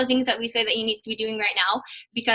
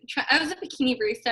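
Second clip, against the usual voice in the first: ordinary talk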